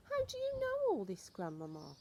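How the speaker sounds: put-on very high, wondering child; boy's voice. Boy asks grandmother how she knows, high and amazed. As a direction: squeak it out like an amazed little boy.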